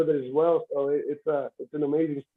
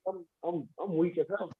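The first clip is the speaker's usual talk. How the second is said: soft, deflated self-quote; retold bummed out; 7 dB quieter than usual. Retelling his first losses to himself, quietly bummed out. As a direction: drop the volume, mildly dejected. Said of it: "I bummed out a little bit".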